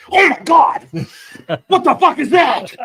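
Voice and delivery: loud, high-pitched scream of terror; panicked friend. Acted. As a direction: shriek it in hammed-up fright. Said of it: "Well, Brian immediately, I hear him".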